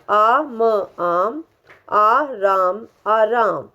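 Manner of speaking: आ म आम read piece by piece, very slowly; slow syllable blending, आ राम आराम; slow sounding out. Shown as a demonstration. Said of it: "sounding out syllables then word".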